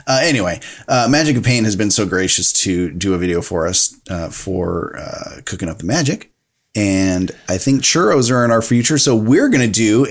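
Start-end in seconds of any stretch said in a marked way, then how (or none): none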